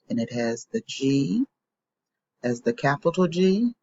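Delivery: usual speaking voice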